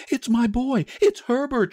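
put-on high-pitched, overwrought old woman; frantic cry. A narrator's reading. Mother struggling in her husband's grip, frantic cry. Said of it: "she cried, struggling mechanically".

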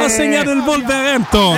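high excited goal shout; breathless italian goal commentary. Real, not acted. Live goal reaction on air.

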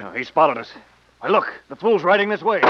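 loud, rising urgent warning; alarmed shout. Acted. Ambusher warns partner the target is coming, alarmed shout. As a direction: call out the danger over gunfire.

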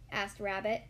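plain reading voice

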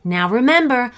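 upbeat host sign-off. Real, not acted. Host addressing the gang after story, loud upbeat sign-off. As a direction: send the listeners off with energy.

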